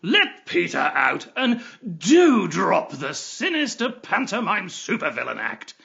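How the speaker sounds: loud, fed-up demand; exasperated outburst. Acted. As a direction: scold emphatically, out of patience. Fed up, scolding the captor.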